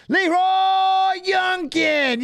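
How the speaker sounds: long, high, stretched shout of the name; drawn-out call. Real, not acted. Calling out the fighter's name at the screen, long high shout. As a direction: belt the name out like a ring announcer.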